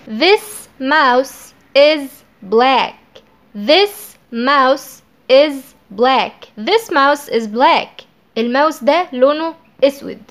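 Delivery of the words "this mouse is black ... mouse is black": slow dictation. Shown as a demonstration. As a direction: dictate it word by word. (نطق بطيء للجملة)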